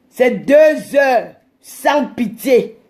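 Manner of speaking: drawn-out shout, each word stretched; slow hype shout. Real, not acted. Hyping her two-hour live to viewers, slow drawn-out shout. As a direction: bellow it slowly like a slogan.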